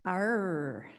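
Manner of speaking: playful pirate "Arr"; pirate growl. Acted. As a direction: toss out a quick pirate growl. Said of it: "International Talk Like a Pirate Sunday".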